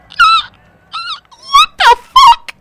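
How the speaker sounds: very high, drawn-out squeal of disbelief; shocked squeal. Retold or imitated. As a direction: stretch it out in a shrill, slow falsetto. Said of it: "in that exact voice".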